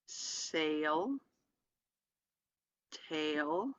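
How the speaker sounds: "gale, pale, sail, tail, dale, hail" one at a time, each rising at the end; reading practice. Shown as a demonstration. Teacher reads line twelve to students, slow rising syllable drill. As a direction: sound out "gale" to "hail" as separate drill items with long pauses and a lift. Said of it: "Here's more reading practice".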